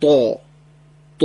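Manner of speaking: دا dark and round, mouth shaped for o; heavy emphatic ta. Shown as a demonstration. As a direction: say دا thick, as Arabic ط built from d. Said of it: "دال رو با این آ ترکیب بکنید". («دا» درشت با دهان گرد)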